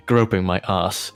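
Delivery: ordinary talk, nothing marked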